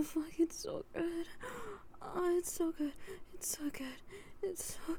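soft aroused whisper; breathy whisper. Real, not acted.

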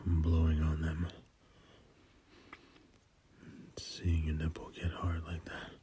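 breathy intimate whisper, very soft; sensual whisper. Acted. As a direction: whisper it close to the ear.

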